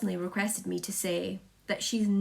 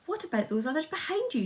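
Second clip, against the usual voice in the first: quick, high shout; 8 semitones higher, faster than usual. A narrator's reading. Police chief shouting up at the peach, quick and high. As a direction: fire the question up, fast. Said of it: "shouted the chief of police".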